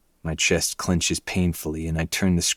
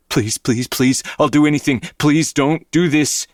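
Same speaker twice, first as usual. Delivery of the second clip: desperate begging; 8 semitones higher, 4 dB louder than usual. A narrator's reading. Victim begging before being burned.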